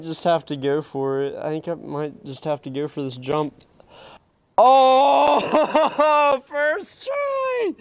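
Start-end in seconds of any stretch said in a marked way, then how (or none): 4.6-7.7 loud triumphant scream; excited scream. Real, not acted. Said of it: "because I screamed so loud".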